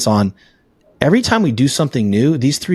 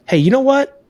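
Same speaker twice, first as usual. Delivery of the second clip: calm backseat self. Retold or imitated. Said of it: "the level three is like".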